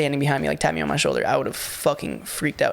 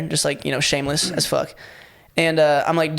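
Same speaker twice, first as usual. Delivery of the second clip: normal talking voice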